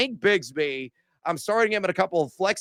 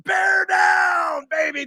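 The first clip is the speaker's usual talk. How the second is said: rallying shout; 12 semitones higher, slower than usual. Real, not acted. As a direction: belt it out like a fan chant. Team sign-off cheer.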